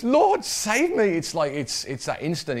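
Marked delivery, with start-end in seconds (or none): 0.0-1.0 high, slow desperate plea for rescue; desperate cry. Shown as a demonstration. Sinking Peter crying out to Jesus, desperate. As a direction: cry out high and drawn-out like a man going under. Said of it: "He's, he's kinda desperate now".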